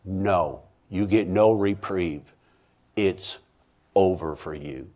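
low, soft, slow verdict; grave, hushed finality; heaven's final refusal. Retold or imitated. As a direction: pronounce doom quietly, with long pauses. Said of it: "and heaven said".

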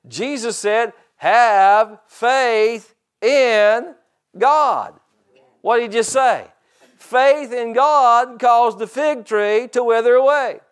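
loud, high, slowly measured proclamation; emphatic preaching. Real, not acted. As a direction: drive each word home to the congregation, with pauses. Preaches at full force.

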